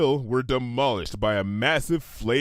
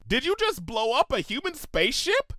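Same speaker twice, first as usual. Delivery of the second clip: horrified; 11 semitones higher than usual. A narrator's reading. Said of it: "Bon Bon asked in horror".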